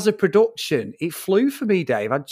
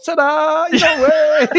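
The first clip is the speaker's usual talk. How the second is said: high, hammed-up cheery ta-da; sing-song cheerful reveal, mocking; mock showman ta-da; 12 semitones higher, 5 dB louder, slower than usual. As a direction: present it like a magic trick. Ron mocking cheer to orphaned Bruce.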